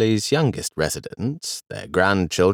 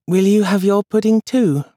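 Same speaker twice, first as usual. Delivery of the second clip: put-on high, hopeful little boy; little boy asking his grandfather; small boy Charles; 12 semitones higher, 5 dB louder than usual. A narrator's reading. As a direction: ask like a tiny hopeful child. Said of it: "he asked".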